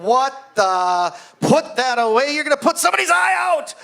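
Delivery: rising to a high, loud shout; startled yell. Retold or imitated. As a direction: yell at him to put it away. Coach yelling at boy.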